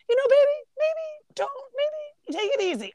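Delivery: high, hesitant voice easing her off; mock gentle dad. The dad hints his daughter should stop eating, in a mock tender voice. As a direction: coax her away from the food, half-joking.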